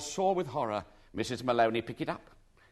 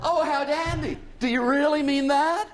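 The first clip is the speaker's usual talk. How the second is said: overjoyed; 13 semitones higher, 6 dB louder than usual. A narrator's reading. Lady overjoyed.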